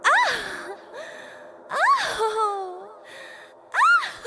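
sudden high squeal; ticklish shriek. Real, not acted. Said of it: "I'm so ticklish".

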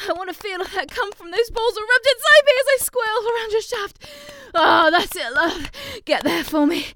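high, loud, breathy begging; passionate pleading. Acted. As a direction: beg with rising urgency. Passionate pleading during sex.

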